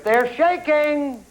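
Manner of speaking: high, drawn-out host's call; calling out answer. Real, not acted. Host checks if the answer is there, calling out. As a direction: stretch it out toward the board.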